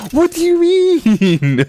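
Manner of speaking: gasping high and slow, playfully offended; mock offended gasp. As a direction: pretend outrage, drawn out.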